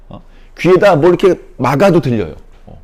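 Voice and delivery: ordinary talk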